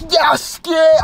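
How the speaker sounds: hammed-up girlfriend cry; mock ecstatic cry. Girlfriend crying out in pleasure, loud high mock. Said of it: "My girl went".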